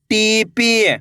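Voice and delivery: टीपी with both ee sounds stretched; टीपी, held long for learners; drawn-out long vowels. Shown as a demonstration. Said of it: "तो आपको खींच के पढ़ना है".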